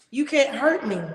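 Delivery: normal talking voice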